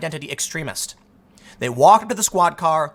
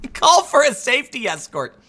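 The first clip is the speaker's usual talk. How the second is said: laughing; 7 semitones higher than usual. Real, not acted. Host rereading the advice to his audience, laughing. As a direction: repeat the phrase through real laughter.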